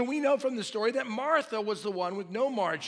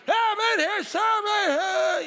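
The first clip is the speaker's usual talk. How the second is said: mock yell for help; 13 semitones higher, 7 dB louder than usual. Martha calling for help by clanging.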